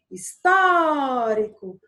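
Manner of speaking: "histórico" with "tó" held long; stress on "tó". Shown as a demonstration. Teacher showing a proparoxytone word, stretched "tó". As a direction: stretch out the "tó" of "histórico", then drop off. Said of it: "onde eu passei mais tempo no tó".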